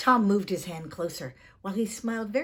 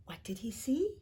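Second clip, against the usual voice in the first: whisper; 7 dB quieter than usual. A narrator's reading. Suspense question to listener.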